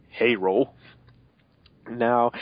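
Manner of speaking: usual speaking voice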